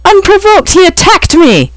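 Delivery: high, loud indignant pixie voice; outraged pixie. A narrator's reading. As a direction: squeal it in outrage.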